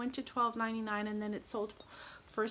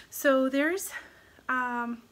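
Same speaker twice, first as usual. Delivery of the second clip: ordinary talk, nothing marked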